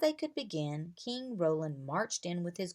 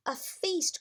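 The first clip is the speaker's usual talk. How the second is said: much higher, eager exclamation; excited cry; 11 semitones higher, 3 dB louder than usual. A narrator's reading. Boy thrilled about the feast, crying out high. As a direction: cry it out with delight. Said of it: "cried Bo".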